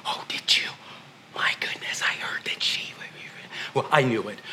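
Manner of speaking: hushed gossiping whisper; gossipy whisper. Retold or imitated. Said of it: "our place is not to".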